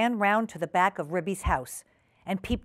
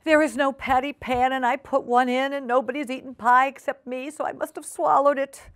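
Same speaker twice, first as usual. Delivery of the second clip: a fretting cat talking to herself, a touch higher; fretful Duchess; 4 semitones higher than usual. Acted. Duchess frets alone over the empty pie dish, slightly anxious. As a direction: puzzle it out anxiously.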